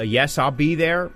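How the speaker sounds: ordinary talk, nothing marked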